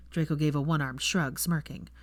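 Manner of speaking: ordinary delivery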